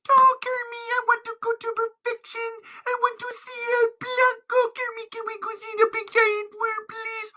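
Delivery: very high begging voice; squeaky pleading character. Pretend. Puppet begging to see the worm, squeaky. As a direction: plead like a tiny cartoon creature.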